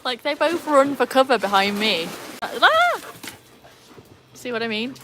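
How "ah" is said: short, high, loud shriek; real yelp of alarm; startled yelp. Real, not acted. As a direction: cry out as something rushes at you. Dogs dash behind her.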